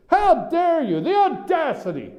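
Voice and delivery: high-pitched, hammed-up indignation; mock outrage. Host mimicking press elite affronted by newcomers, mock outrage. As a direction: act scandalized, voice leaping high.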